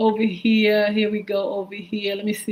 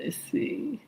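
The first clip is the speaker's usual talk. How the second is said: breathy whisper to herself; 11 dB quieter than usual. Real, not acted. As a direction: whisper it while searching the list. Scanning names in chat.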